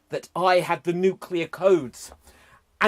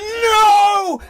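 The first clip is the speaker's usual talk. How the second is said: high, loud cry of horror; a big, loud movie-hero no; horrified scream; 13 semitones higher, 11 dB louder than usual. Acted. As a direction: scream it at the top of your voice.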